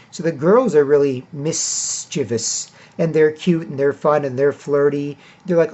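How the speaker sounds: ordinary talk, nothing marked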